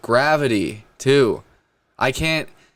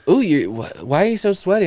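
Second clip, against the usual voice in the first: higher-pitched mock questioning voice; imitated partner; 7 semitones higher than usual. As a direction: mimic a partner's puzzled question. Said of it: "they always go like".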